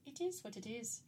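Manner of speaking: soft put-on Irish accent. Shown as a demonstration. Repeating her own catchphrase, soft playful Irish accent. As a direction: toss it off softly, Irish-style. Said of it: "I always do it with, like, an Irish accent".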